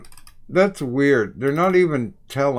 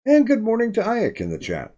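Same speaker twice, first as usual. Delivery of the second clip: high, bright sing-song good morning; high, cheerful hello to the chat; cheery greeting; 9 semitones higher than usual. Real, not acted.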